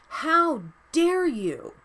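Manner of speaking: real outrage, drawn out; outraged rant. Real, not acted. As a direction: demand an answer in fury. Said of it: "Sorry, rant over".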